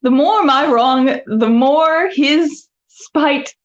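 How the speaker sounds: theatrical, high-pitched stage recitation; dramatic Shakespeare reading. Acted. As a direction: declaim it like a stage actress. Performs Katherine monologue, Shakespeare.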